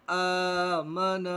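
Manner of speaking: आदम with the first आ held long; slowly drawn out. Shown as a demonstration. Teacher drawing out आदम for the class. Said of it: "खींचेंगे".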